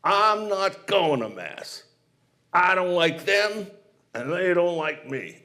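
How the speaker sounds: put-on slow, deliberate, sulky boy; stubborn refusal. Young Billy refusing his mother, slow and sulky. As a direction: drag out each word in defiance.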